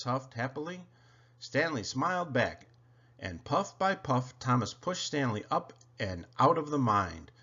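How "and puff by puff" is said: ordinary delivery, nothing marked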